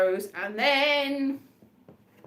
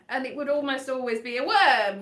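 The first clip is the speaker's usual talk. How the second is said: normal talking voice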